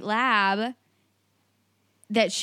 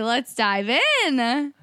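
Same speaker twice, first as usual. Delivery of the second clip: drawn-out; 2 semitones higher, much slower than usual. Acted. Drawn-out show catchphrase.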